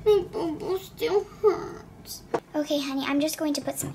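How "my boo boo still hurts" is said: put-on whiny crying child. Hurt child whines to nurse.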